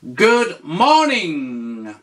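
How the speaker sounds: slow, clear teacher model; slow model phrase. Shown as a demonstration. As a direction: say it slowly for the learner. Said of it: "tu lui dis".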